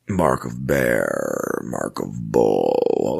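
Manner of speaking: very low, drawn-out, ponderous voice; Ulysses. Shown as a demonstration. Host shows how the character leaves out words, slow deep impression. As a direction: stretch each word slowly in a deep voice. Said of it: "he talks like that".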